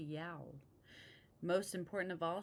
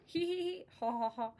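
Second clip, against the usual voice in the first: acted witchy laugh in distinct syllables; witch cackle; 7 semitones higher, 4 dB louder, faster than usual. A narrator's reading. Tickled old witch, high mock cackle. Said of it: "cackled the old witch".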